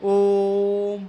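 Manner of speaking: ओम held very long and slow; teacher drawing out ओम as prolonged vowel. Shown as a demonstration. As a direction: stretch ओम out like a chant. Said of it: "जैसे ओम".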